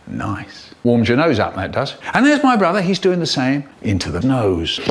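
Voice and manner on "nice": quiet, low contented "nice"; low satisfied murmur. Acted. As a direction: savour it under your breath. Satisfied sniff after snorting.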